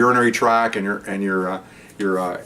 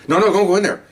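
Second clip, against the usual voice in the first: higher, slightly raised urgent order; urgent warning; 9 semitones higher, 4 dB louder, faster than usual. Retold or imitated. Sergeant stopping a rookie opening the door, urgent warning. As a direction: imitate a supervisor stopping someone abruptly.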